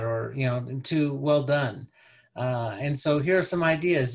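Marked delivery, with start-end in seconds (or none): none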